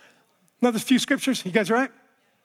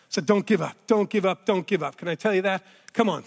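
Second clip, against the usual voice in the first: usual speaking voice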